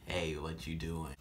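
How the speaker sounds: slow, low and quiet; low slow guy voice. Retold or imitated. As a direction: drawl it like a bored guy. Voices the caller.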